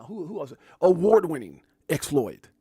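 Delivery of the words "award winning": plain hype call. Shown as a demonstration. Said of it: "That sounds way better".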